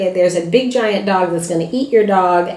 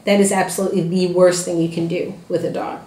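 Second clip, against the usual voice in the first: ordinary talk, nothing marked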